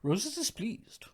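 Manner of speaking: high, slow, mock-concerned quote; hammed-up villain musing aloud; haughty film villain. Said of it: "I also love when he does".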